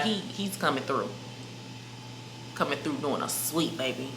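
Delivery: ordinary delivery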